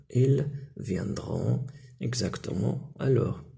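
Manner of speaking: drawn far out, word by word; very slow model sentence. Shown as a demonstration. Teacher repeating the answer very slowly for pupils. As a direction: stretch every syllable for the class. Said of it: "واش نقولوا؟".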